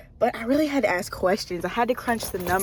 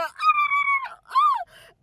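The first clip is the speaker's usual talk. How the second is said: much higher, a happy shriek; joyful scream. Real, not acted. Speaker overcome about her new office, with a joyful scream. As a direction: let out an overjoyed scream.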